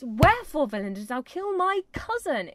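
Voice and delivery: loud, indignant demand; angry Juliet. Shown as a demonstration. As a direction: hurl the accusation at him. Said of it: "It's angry".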